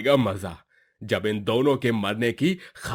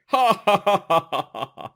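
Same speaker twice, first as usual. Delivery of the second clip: hearty laugh; 7 semitones higher than usual. Acted. Praising the food, laughs.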